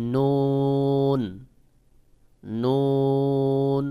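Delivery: নুন held very long, tongue pressing slightly; slow Arabic নুন on a level note; tongue-pressed নুন. Shown as a demonstration. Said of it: "জিহ্বাটা একটু চাপ দিয়ে উচ্চারণ".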